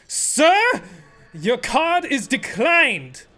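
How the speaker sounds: mock clerk, loud and high, slowly spelling it out; rude clerk. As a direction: talk down to him like a rude clerk. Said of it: "That is how he spoke to me".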